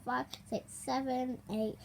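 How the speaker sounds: plain reading voice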